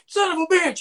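yelling the curse high and loud in disbelief; excited curse. Retold or imitated. Hearing his son found the figure, he yells high and loud.

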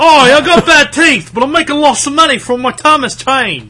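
high, hammed-up English accent; mock English accent. English guy bragging about his Thomas money, hammed-up English accent. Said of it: "That was a good accent".

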